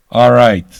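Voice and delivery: sudden loud welcoming all right. Real, not acted. Host to returning fans, a loud all right. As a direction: boom it out.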